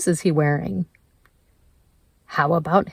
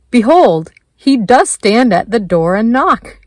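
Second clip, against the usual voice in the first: louder, higher, declaring voice; scriptural proclamation; 4 semitones higher, 8 dB louder than usual. Acted. Proclaims scripture within the meditation.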